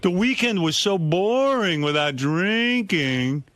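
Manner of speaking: slow, high, sulky drawl; mock whiny boredom. Host mimicking Richard's dull dry weekend, mock whiny. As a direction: whine like a bored drunk. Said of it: "He goes".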